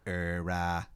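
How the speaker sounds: low, subdued bad Boston accent; awful Boston accent. Colonists let down by Danny's answer, low Boston accent. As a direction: hesitate in fake Bostonese. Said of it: "he says in that awful accent".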